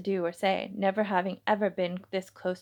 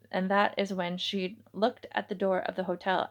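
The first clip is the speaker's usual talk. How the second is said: ordinary delivery, nothing marked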